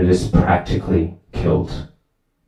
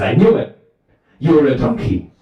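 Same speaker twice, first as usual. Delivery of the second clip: high-pitched indignant retort; acted accusing yell; 6 semitones higher than usual. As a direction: fling the accusation back, pitched up. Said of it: "they start yelling at each other".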